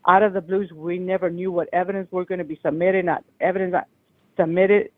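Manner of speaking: ordinary talk, nothing marked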